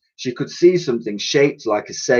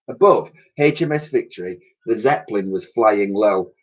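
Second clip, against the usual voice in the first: ordinary delivery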